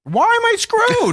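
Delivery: high, slow alarmed question; shocked disbelief; surprised. Real, not acted. Alarmed at being screwed.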